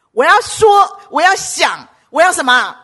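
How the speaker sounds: usual speaking voice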